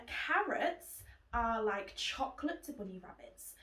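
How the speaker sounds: ordinary delivery, nothing marked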